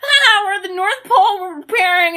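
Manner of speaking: high-pitched gleeful babble; mock fun excitement. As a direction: gush like a holiday movie at play. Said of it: "this fun".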